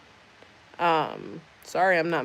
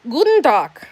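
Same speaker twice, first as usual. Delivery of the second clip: loud and much higher Guten Tag; excited German greeting; 7 semitones higher, 8 dB louder than usual. Acted. Greeting Christelle in German with a loud, high call. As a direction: call out the greeting brightly, pitched up.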